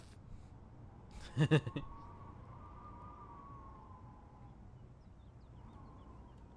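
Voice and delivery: unvoiced giggle, way up high; breathless high laugh. Real, not acted. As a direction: burst out laughing at yourself. Laughs after falsetto girl voice.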